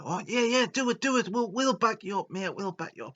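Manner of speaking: high eager cheering; mock cheering crowd of mates; excited friends egging on.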